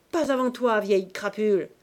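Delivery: loud, scornful jab; insulting retort. A narrator's reading. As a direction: hurl it back in his face. Insult quarrel.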